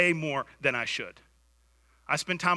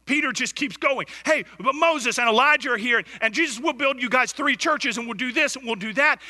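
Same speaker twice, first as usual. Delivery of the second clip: rising, louder, driving sermon voice; fervent preaching; 7 semitones higher, 5 dB louder than usual. Acted. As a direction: push the story hard from the pulpit. Preaching at full force.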